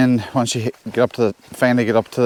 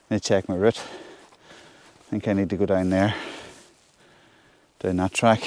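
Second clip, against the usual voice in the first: muttering to self; 3 semitones lower, 7 dB quieter than usual. Real, not acted. Muttering while checking the route.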